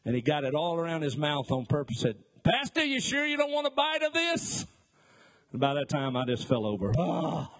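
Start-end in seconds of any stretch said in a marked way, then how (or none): 2.4-4.7 high, sing-song mock offer; sly teasing offer. Deacon teasing the seasick pastor with food, high and sly. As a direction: dangle the sandwich with glee. Deacon taunting with mayonnaise sandwich.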